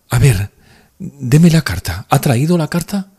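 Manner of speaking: high, loud, eager woman's voice; urgent demand. A narrator's reading. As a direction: press for it impatiently. She urgently demands the letter.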